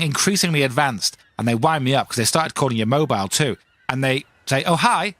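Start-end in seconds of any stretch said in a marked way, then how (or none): 4.6-5.1 lightly acted, higher-pitched caller; real-voice phone caller. Cold caller greeting whoever picks up, real-voice caller. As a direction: chirp a casual greeting in a higher voice. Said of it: "it's a real voice".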